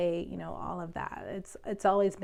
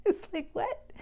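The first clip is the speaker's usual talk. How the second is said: laughing; 10 semitones higher, 5 dB quieter than usual. Real, not acted. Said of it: "I'm like, laughing the whole time".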